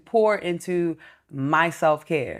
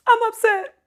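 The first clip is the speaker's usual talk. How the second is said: much higher, whiny put-on voice; imitating upset friend; 4 dB louder than usual. Woman mimics her best friend complaining on the phone, whiny and high. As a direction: whine it like a sulking friend. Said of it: "She said".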